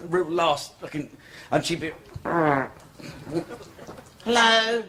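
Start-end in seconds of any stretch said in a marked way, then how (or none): 4.2-4.8 high, loud-ish mock woman's hello; prostitute's call. Prostitute hails a passer-by, high put-on voice. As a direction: call out as a comic streetwalker.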